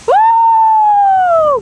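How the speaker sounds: high, loud cheering whoop; excited whoop. Real, not acted. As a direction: cheer the dog on loudly. Cheering dog over jump.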